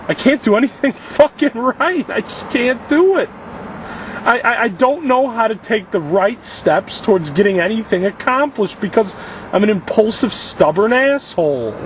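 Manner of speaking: high-pitched, despairing rant; distraught outburst, slowing on the last insult; anguished self-berating. Real, not acted. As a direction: tear into yourself in real frustration. Berates himself while stranded, distraught.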